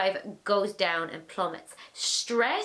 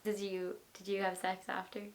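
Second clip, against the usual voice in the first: dropped to a soft hush, lower; 2 semitones lower, 10 dB quieter than usual. Real, not acted. Asking the guest about sex after, in a soft hush. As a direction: lean in and ask it softly, conspiratorially. Quietly asking a cheeky question.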